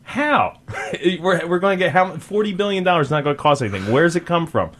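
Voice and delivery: high, disbelieving questioning with a laugh; mock incredulous interviewer. Host imagining grilling the ad guest, high disbelief. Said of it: "I as an interviewer would go".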